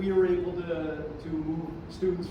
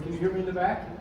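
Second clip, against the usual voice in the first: teacher voice; 4 semitones higher, 5 dB louder, faster than usual. Real, not acted. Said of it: "Are you my teacher voice?".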